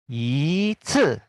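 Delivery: normal talking voice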